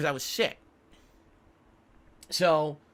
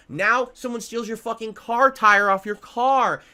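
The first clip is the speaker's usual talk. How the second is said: angry rant; 4 semitones higher, 6 dB louder, slower than usual. Real, not acted. Ranting about misfortunes.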